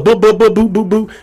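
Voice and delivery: high, silly "boo boo" babble; dismissive lovestruck gibberish; mocking babble. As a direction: drown out their excuses with nonsense.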